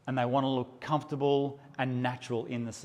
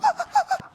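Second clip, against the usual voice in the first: very high squealing laugh; 6 dB louder than usual. Retold or imitated. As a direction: squeal with glee. Excited laugh.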